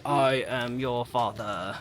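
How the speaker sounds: slow, low Darth Vader line. Pretend. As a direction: intone it like the masked villain. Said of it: "And say".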